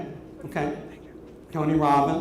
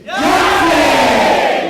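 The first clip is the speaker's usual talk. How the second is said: even louder, high all-out yell; level ten shout; 11 semitones higher, 12 dB louder than usual. Shown as a demonstration. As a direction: give it everything you've got. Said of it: "one more at level ten".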